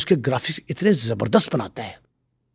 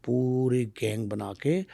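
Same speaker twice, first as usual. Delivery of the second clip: normal talking voice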